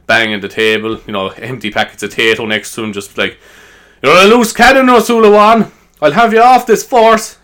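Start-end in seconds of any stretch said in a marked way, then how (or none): 4.0-7.4 loud, high-pitched angry shout; furious hammed-up dressing-down; angry sergeant. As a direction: bellow like a sergeant tearing into an officer. Acted sergeant banging the table.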